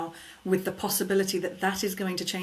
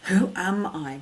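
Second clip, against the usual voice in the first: deliberate WHO AM I, weight landing on AM; WHO AM I with a firm, spaced-out AM; emphasis on AM; slower than usual. Shown as a demonstration. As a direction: stress AM as a sincere, empowering question. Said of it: "Change the emphasis".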